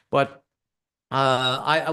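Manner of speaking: normal talking voice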